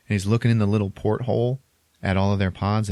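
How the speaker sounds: ordinary delivery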